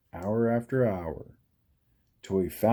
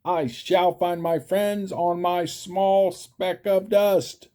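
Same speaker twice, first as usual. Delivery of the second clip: higher, louder determined cry; 8 semitones higher, 5 dB louder than usual. A narrator's reading. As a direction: vow it loudly, high and firm. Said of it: "cried Horton".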